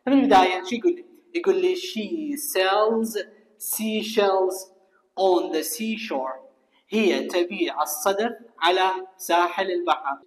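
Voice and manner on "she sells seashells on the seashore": very slow, drawn-out tongue twister, word by word; slow model reading. Shown as a demonstration. Teacher reads the tongue twister for students to repeat, slow model reading. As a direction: read slowly and a little softly for learners.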